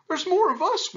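very high, frightened monkey voice; whimpering. A narrator's reading. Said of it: "whimpered the monkeys".

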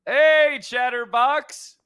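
loud shout, name drawn out slowly; calling out loud. Real, not acted. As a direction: yell his name across the street. Calling out to NPC Chatterbox.

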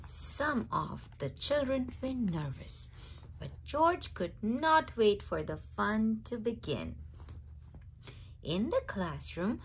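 ordinary delivery, nothing marked

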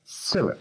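Seven with "Se" stressed high; Seven, high first syllable for learners. Shown as a demonstration. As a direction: punch the start of Seven. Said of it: "en la primera sílaba".

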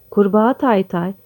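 ordinary delivery

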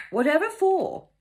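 high, loud mock-posh sniff; posh dismissive. Dora mocking Miss Amy to cook, in a loud posh voice. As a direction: imitate the lady's haughty brush-off. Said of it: "speak in a posh, dismissive voice".

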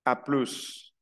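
"a plus" ending on a clear hissed S; short French "a plus" with S sounded; pronounced final S. Shown as a demonstration. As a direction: say "a plus" as "a pluss". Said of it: "Ahí sí menciono la S final".

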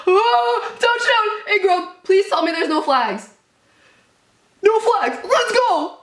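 very high, loud celebratory shouting; ecstatic touchdown scream. Real, not acted. Fan celebrating a touchdown and fearing flags, ecstatic. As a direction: scream for joy, pleading midway.

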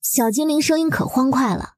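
usual speaking voice

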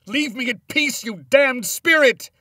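very high, full-force scream; furious scream. A narrator's reading. Tormented man ordering his wife's spirit away, screaming. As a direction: scream to banish a tormenting ghost. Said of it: "I screamed".

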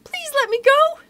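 very high pleading mouse voice; squeaky mouse. Acted. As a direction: squeak a desperate plea. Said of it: "Mouse cried".

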